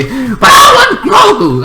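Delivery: mock high-pitched protest; demanding shout. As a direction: yell it like an insistent demand. Acting out the Mandalorian demanding Grogu.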